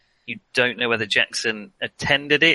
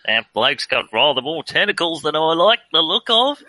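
laughing Aussie bloke, a bit louder; aussie tradesman; 4 semitones higher, 4 dB louder than usual. Acted. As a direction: lay on a broad Australian accent. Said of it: "best of [name]'s accents".

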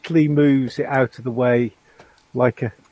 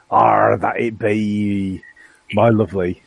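slow West Country pirate drawl; pirate accent; 5 semitones lower, slower than usual. Shown as a demonstration. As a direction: growl it like an old pirate. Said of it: "It's not in my normal".

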